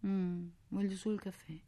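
put-on small, tearful child's voice; through sobs. A narrator's reading. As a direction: answer meekly while crying. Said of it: "dissi io tra i singhiozzi". (tra i singhiozzi, piano)

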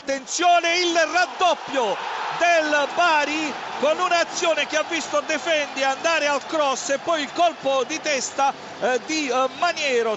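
loud, urgent goal shout; breathless italian goal commentary. Real, not acted. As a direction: shout the goal over the crowd.

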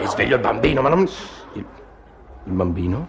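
stunned quiet disbelief; hushed shock at the news. Acted. Husband stunned by baby.

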